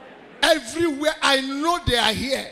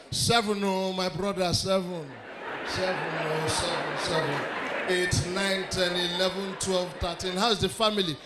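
low, mumbled counting with chat mixed in; lightly voiced distracted counter; 5 semitones lower, 7 dB quieter than usual. Igbo man counting money while you greet him, low mumble. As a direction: keep counting while half-answering. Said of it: "let me show you".

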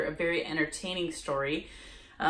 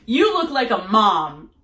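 blunt remark; 3 semitones higher, 10 dB louder, slower than usual. Retold or imitated. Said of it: "And she goes".

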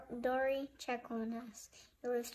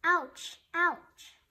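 two loud, high yelps; sharp repeated cry of pain; yelled in pain; 5 semitones higher, 6 dB louder than usual. A narrator's reading. Fuzz steps on a nut with his knee, yelling. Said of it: "Fuzz yelled again".

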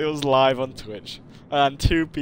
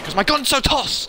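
higher, louder frustrated complaint; frustrated outburst; 12 semitones higher, 7 dB louder than usual. Real, not acted. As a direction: vent at the useless gun. Frustrated at his gun.

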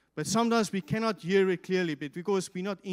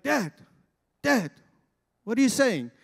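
higher, louder call for Dad; children calling out; 4 semitones higher, 5 dB louder than usual. Retold or imitated. Children getting closer to their whispering father, imitated calling out.

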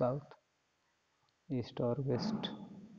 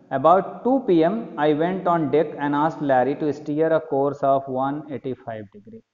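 slow emphatic reread; 6 dB louder, much slower than usual. Shown as a demonstration. Rereads a line to explain.

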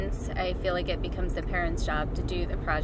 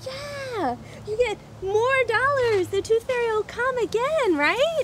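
sing-song excitement; 13 semitones higher than usual. Real, not acted. Mom cheering child about tooth fairy.

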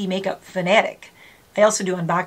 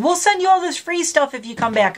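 mock sales pitch; 7 semitones higher than usual. Mimicking company's pitch.

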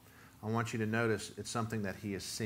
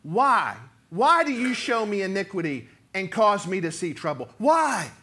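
much louder, higher pleading why; desperate cry; 7 semitones higher, 12 dB louder than usual. Shown as a demonstration. Preacher voicing the prophet's desperate question to God, loud. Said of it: "that is how he's starting this book".

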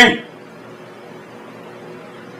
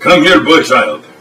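broken-English Russian host, deadpan; mock Eastern European TV host; fake Russian accent. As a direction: play a stern Russian cook in broken English. Boris sketch continues.

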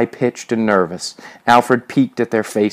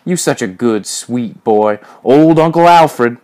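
mocking taunt; 3 semitones higher, 5 dB louder than usual. A narrator's reading. Major taunts Alfred as a sweet boy, mocking. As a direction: taunt him, stretching the nickname loudly.

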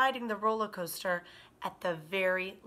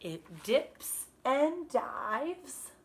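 drawn-out reading; 2 semitones higher, 4 dB louder, much slower than usual. Acted. Reading the ride's plunge.

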